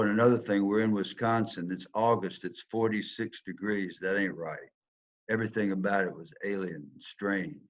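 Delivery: usual speaking voice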